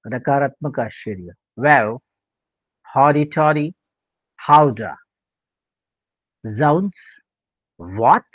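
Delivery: ordinary talk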